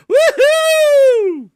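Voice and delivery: high, loud whooping cheer; excited cheer. Real, not acted. Cheering Phil's news tease with a loud whoop.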